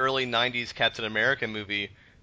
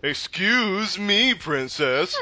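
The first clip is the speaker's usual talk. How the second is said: high, loud, very drawn-out sass; hammed-up cartoon catchphrase; imitated cartoon hero; 8 semitones higher, 4 dB louder, much slower than usual. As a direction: stretch it out in mock-cartoon indignation. Imitates crappy animated Zelda games.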